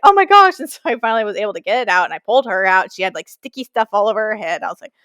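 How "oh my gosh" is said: high, loud alarmed gasp of words; frantic high cry; panicked exclamation. Retold or imitated. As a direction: blurt it while yanking the stuck carrier. Dog's carrier stuck under seat.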